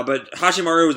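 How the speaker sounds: ordinary delivery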